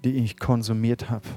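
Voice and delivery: soft, hushed prayer for the room to repeat; quiet led prayer. Acted. Pastor leading the congregation in a confession prayer, hushed and earnest. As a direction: pray it gently, line by line, for others to echo.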